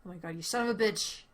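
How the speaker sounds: ordinary talk, nothing marked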